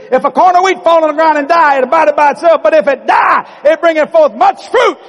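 high, loud shouted scripture; booming proclamation. Acted. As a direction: thunder it out like a prophetic pronouncement. Jesus gives Peter the same pronouncement.

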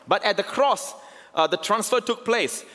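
high, slow, building sermon voice; fervent preaching. Acted. Pastor pressing the cross on his congregation, fervent preaching. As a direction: preach it to the back row.

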